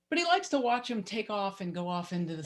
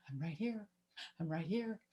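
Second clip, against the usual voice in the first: much softer, a distant little call; faint far-off calling; 12 dB quieter than usual. Retold or imitated. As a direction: let it fade away like a call from the treetop. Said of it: "like, calling to us".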